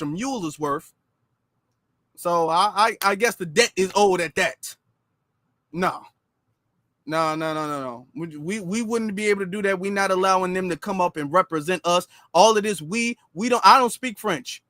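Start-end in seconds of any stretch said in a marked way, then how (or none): none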